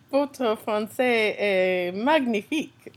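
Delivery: slow, put-on French voice; mock French compliment. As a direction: praise her French with playful slowness. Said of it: "Okay, back to English".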